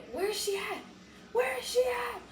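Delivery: high, squealed demand; retold going off. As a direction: mimic yourself frantically demanding an answer. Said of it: "I'm going off".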